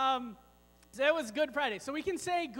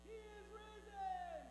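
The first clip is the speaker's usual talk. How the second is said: excited shout; 8 semitones higher, 15 dB quieter, slower than usual. Shown as a demonstration. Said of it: "Pastor [name] and I together".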